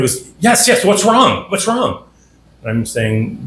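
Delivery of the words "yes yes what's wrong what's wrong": high, slow, panicky yes yes; a startled captain fearing bad news; alarmed frantic. Retelling the captain's alarmed, high-pitched reply. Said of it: "this frantic man's looking".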